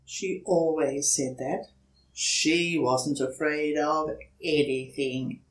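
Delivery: ordinary delivery, nothing marked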